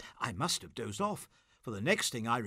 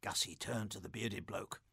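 ordinary delivery, nothing marked

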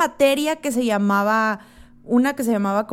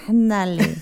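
ordinary talk

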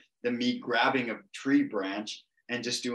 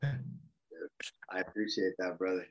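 soft whisper; 3 semitones lower, 6 dB quieter, slower than usual. Real, not acted. Reacting to compliment on looks.

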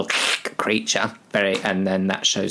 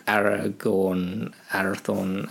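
ordinary talk

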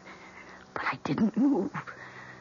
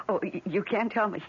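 ordinary talk

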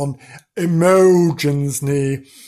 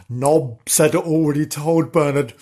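plain reading voice

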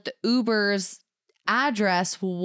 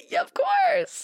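very high, emotional squeaky acceptance; overwhelmed yes; much slower than usual. Retold or imitated. Accepting her fiancé's proposal, very high and overcome. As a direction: gush it out overcome.

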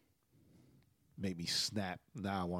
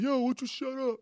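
high-pitched street heckle; comic high-voiced taunt; mock heckler; 13 semitones higher than usual. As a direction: play a passer-by butting in, pitched way up. Imagined passer-by provoking him.

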